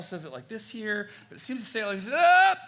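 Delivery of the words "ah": much higher, loud exclamation; mock triumphant ah. Mocking the cliche-user's gotcha, triumphant. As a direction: crow it like you just won.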